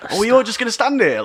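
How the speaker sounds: high, fast retold yell at the crowd. To a crowd watching a stabbing, a loud high retold yell. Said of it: "yelled out loud".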